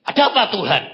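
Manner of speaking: high, loud startled question; startled reply. Retold or imitated. Preacher woken by God, startled and loud. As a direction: jolt awake and ask.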